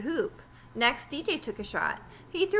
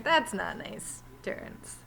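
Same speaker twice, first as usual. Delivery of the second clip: hushed aside; 4 dB quieter, slower than usual. Acted. Reader's aside scolding the character.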